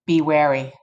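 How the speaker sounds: ordinary delivery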